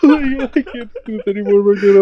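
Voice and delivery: sobbing. Real, not acted. Despair at endless game.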